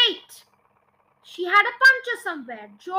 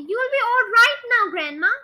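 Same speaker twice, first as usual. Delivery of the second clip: raised, confident voice; proud announcement; 6 semitones higher, 6 dB louder than usual. A narrator's reading. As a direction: announce it brightly to her. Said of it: "George announced proudly".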